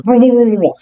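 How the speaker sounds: high, wobbling creature noise; creature's warbling cry. Acted. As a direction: let out a rolling monster warble. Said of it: "the creature, ... It goes".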